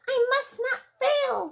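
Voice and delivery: high, determined little snail voice; a tiny snail steeling herself. Acted. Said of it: "said the tiny snail".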